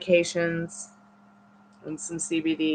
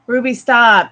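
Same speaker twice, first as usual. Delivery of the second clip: sharp, louder call to stop the dog; firm call to the dog; 5 semitones higher, 11 dB louder, slower than usual. Real, not acted. Owner calling her dog to stop, loud and firm.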